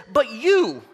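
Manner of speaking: preacher leaning into "But you"; emphatic. Retold or imitated. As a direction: hit "But you" hard and loud. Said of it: "emphatically he goes".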